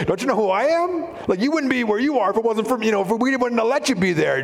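high, emphatic acted retort; turned-back challenge. Jesus answering Pilate back, acted high-pitched retort. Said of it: "Jesus is telling him".

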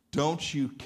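ordinary delivery

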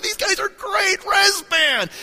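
high, loud, slow cheer; fan cheer. Retold or imitated. Young fan shouting praise for Res Band, high and loud.

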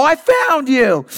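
high, stretched-out gleeful call; playful discovery. Retold or imitated. Dad throwing off the blanket to find his daughter, playfully triumphant. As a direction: squeal it in mock surprise, drawing it out. Dad finds hiding child in game.

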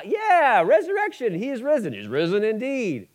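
chirpy high churchy cliché; mock hollow churchgoer. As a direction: parrot the Easter phrases in a chirpy false voice. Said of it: "we go".

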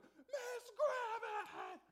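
high, drawn-out frightened shriek; scared scream. Retold or imitated. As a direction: scream it like you're terrified at the door. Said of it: "I just went".

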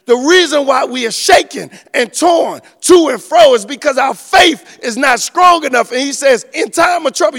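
loud, high, climbing sermon delivery; full-force preaching. Acted. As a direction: preach it out to the whole church.

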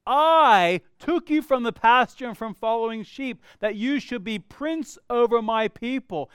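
measured, solemn reading of God's words; scripture reading. Acted. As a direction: read it with weight.

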